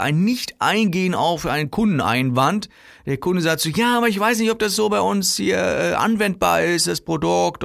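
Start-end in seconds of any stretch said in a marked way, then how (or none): 3.7-7.6 higher-pitched, hesitant customer voice; doubtful customer. Acted. Customer doubting the product fits, hesitant.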